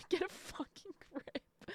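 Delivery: breaking up with laughter mid-scolding; slow, voiceless, laughed-out telling-off; laughing exasperation. Real, not acted. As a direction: squeeze the words out through a laugh. Laughing while scolding the heroine.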